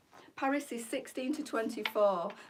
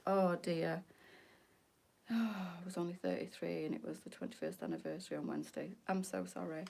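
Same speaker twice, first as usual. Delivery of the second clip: ordinary delivery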